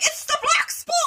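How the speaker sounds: piping falsetto warning; squeaky pirate cry. Retold or imitated. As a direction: squeal it out like a panicked Muppet pirate. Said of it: "they're like".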